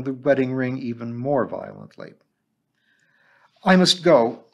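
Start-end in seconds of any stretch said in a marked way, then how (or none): 3.6-4.5 sudden, louder outburst; blurted out. A narrator's reading. Missus Bonney finally confessing to the teacher, blurted. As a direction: let it burst out before she can stop. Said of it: "she blurted out".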